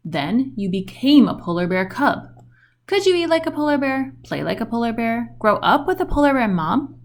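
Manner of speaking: plain reading voice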